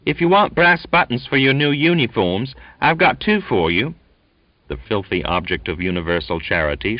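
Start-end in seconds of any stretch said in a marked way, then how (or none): 0.0-4.0 put-on high squeaking boy's taunt; squeaky youngster. A narrator's reading. Young Charlie taunting the filthy newcomer, high squeak. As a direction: squeak it out, cheeky and pitiless.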